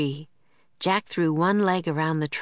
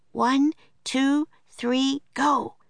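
Annie counting; 9 semitones higher, slower than usual. A narrator's reading. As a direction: count it off like an excited little girl. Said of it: "said Annie".

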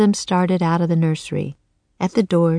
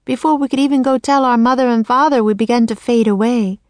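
put-on fast, higher anxious girl; hurried girl voice; 6 semitones higher, 4 dB louder, faster than usual. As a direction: rush through it like a worried child. Girl recalls fading, hurried.

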